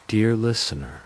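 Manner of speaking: plain reading voice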